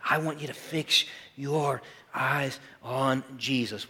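deliberate word-by-word insistence; measured, weighty answer; slow, emphatic command. Acted. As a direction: punch each word slowly and firmly. Emphatic answer to the acted protest.

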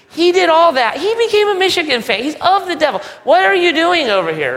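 high, loud, sneering rant; mocking older brother. Older brother ranting to his father about his sibling. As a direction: whine it out, outraged and petty.

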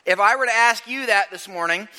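ordinary talk

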